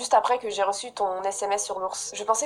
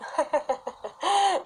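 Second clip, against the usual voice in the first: laugh; 6 semitones higher, 3 dB quieter than usual. Real, not acted. Laughs at camping invite.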